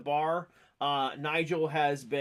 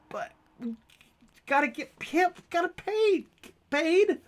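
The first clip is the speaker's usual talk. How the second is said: high-pitched, stammered protest; flustered protesting character; 12 semitones higher, 4 dB louder than usual. Acted. Character insists on getting paid, high and flustered. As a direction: blurt it high, stumbling over the words.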